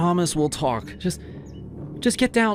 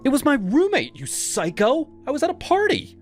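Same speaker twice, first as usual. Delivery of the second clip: much higher, exasperated outburst; fed-up, furious girlfriend; exasperated anger. Acted. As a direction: scold him in disbelief. Ava argues angrily.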